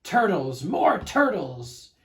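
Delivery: loud, slow bellowed order; bellowing command. Acted. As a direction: bray out the demand. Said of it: "he bellowed and brayed".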